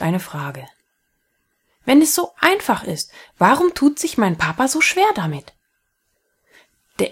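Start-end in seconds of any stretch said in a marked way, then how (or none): 1.8-5.5 put-on higher, earnest little boy asking; lightly voiced boy wondering aloud; curious child. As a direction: ask it like a puzzled schoolboy. Child Fritzchen asks.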